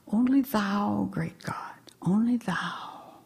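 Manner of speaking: low, breath-heavy near-whisper, fading; humble devotion. Acted. Meditator surrenders to great God, hushed humble devotion.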